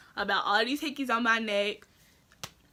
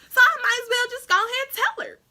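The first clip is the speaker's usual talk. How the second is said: higher, louder, close to tears; voice cracking; 11 semitones higher, 6 dB louder, faster than usual. Real, not acted. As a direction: push through the words on the edge of crying. Confessing nerves about telling mom.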